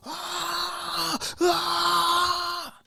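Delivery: high, drawn-out imitated scream; high mock wailing cry. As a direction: wail it like a screaming patient. Screaming patient in his room.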